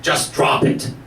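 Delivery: low, voiceless echo of the teacher; Oprah. Retold or imitated. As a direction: repeat it as a hushed, punchy pronouncement. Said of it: "And Oprah says".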